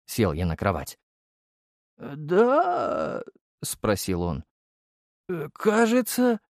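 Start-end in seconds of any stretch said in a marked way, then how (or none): 2.0-3.2 dazed question. A narrator's reading. Dazed deputy just woken. 5.3-6.4 slow, high, hesitant reply; high-pitched sleepy uncertainty; dazed and unsure. A narrator's reading. Dazed deputy just woken.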